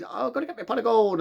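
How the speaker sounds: imitating a hammed-up Irish leprechaun; Irish leprechaun accent. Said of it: "does this, like, weird little Irish accent".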